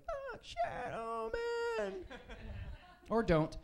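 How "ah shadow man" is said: much higher, very slow, drawn-out cry; awed audience cry. Acted. Comic telling the audience how to react, with a much higher, slow awed cry. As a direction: swoon at the punchline. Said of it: "that's when you go".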